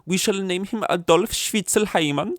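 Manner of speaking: high-pitched, hammed-up German accent; mock German accent. German parent settling on the baby's name, in a mock German accent. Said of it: "German's like".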